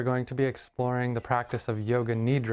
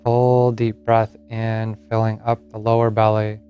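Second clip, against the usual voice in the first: guided meditation; 10 dB louder than usual. Acted. Yoga teacher settles listeners into Yoga Nidra, guided meditation. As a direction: lead them gently into rest, phrase by unhurried phrase.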